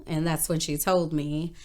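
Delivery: usual speaking voice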